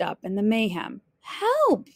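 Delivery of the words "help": very high, drawn-out cry; crying for help. A narrator's reading. Little Red caught in the mayhem, high cry. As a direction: cry out for rescue. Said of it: "she cried".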